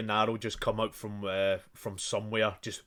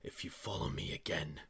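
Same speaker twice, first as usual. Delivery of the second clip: lower, whispered, much softer; whispered threat; 6 semitones lower, 14 dB quieter than usual. Acted. Assassin whispering a threat as the player falls, menacing. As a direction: breathe the threat into his ear.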